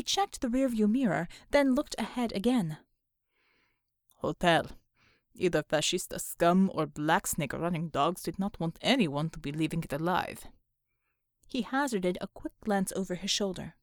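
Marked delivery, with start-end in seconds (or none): 4.2-10.5 low Russian-sounding man's voice; deep-pitched foreign soldier assessing danger; stoic comrade driver, matter-of-fact. Acted. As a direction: report it low in broken English. Untermensch figure in the story.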